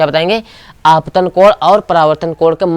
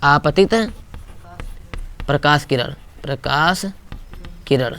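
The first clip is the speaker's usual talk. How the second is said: आपतित प्रकाश किरण dictated word by word, softer, with pauses; teacher dictating a term, slow and soft; slow dictation; 2 semitones lower, 10 dB quieter, slower than usual. Shown as a demonstration. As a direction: spell it out slowly for the class to copy. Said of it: "dictating term for notes".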